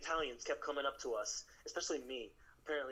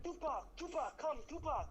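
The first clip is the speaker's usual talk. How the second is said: mock calling out; 11 semitones higher than usual. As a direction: call him over like an excited fan. Locals calling him [name].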